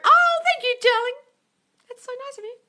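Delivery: very high, touched thank-you; delighted thanks. Real, not acted. Host thanking a viewer for a compliment, very high and delighted. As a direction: gush thanks for the kind words.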